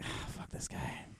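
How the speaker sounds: breathy whispered grumble; whispered aside. Retold or imitated. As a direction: whisper it under your breath. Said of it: "I think I'd be like".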